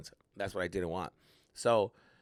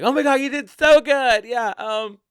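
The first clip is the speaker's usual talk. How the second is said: loud, high-pitched mock gushing; gushing compliment; 14 semitones higher, 13 dB louder than usual. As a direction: squeal praise like an over-excited fan. Guests gushing over other comics.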